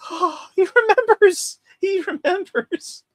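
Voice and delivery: very high, drawn-out fanboy squeal; mock emotional. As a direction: reenact being overcome, slow and way up high. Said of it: "I'm gonna get emotional".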